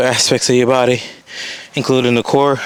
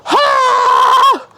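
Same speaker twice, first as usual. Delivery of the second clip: straining; much slower than usual. Real, not acted. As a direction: yell through the burn. Burning glutes mid workout.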